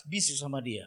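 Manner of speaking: low, softened voice short of a whisper; hushed advice. Shown as a demonstration. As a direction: drop the voice as if keeping a secret. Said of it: "Jangan saudara keras-keras".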